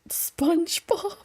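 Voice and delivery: high slow cartoon shout. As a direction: call it like a goofy sponge. Cartoon character voice.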